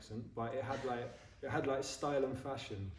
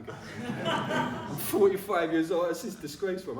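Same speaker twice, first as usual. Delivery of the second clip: laughing at himself; 6 semitones higher, 8 dB louder, slower than usual. Real, not acted. Laughing at own age.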